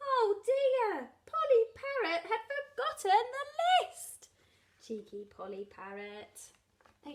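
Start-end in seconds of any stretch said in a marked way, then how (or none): 0.1-4.3 high, loud storyteller's dismay; exclaimed dismay. A narrator's reading. Reader reveals the parrot forgot, exclaimed dismay. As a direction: exclaim it high, as news of a mishap.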